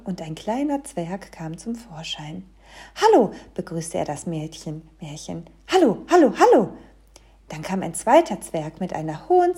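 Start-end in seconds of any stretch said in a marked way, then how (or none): 3.0-3.3 high, loud little dwarf; greeting dwarf. A narrator's reading. Little dwarf greets the visitor, high and loud. 5.7-6.7 high, loud little dwarf; repeated high, loud hellos; greeting dwarf. A narrator's reading. Hallo dwarf greets the visitor, high and loud.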